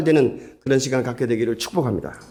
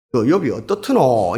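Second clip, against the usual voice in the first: Gyeongsang dialect; 3 semitones lower than usual. Acted. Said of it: "이제 그다음부터는 경상도 말로 하는 거죠".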